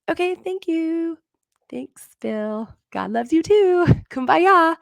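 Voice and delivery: high, giggly mock reply with a sing-song Kumbaya; laughing brush-off. To her coach, laughing off his God loves you, high and mocking. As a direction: laugh it off as if it's corny.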